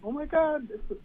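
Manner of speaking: high squealing girly gush, imitated; excited girls. Comic imitating crowd girls spotting the tiny dog, high squeal.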